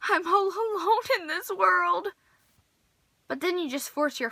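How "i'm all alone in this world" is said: melodramatic lament. Pretend. No fandom to share a book with.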